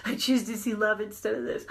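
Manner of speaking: said through a light laugh; half-laughing affirmation. Shown as a demonstration. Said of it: "And I might be saying".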